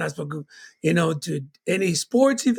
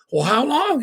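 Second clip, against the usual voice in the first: put-on worried kid question; 7 semitones higher than usual. Retold childhood talk with mom.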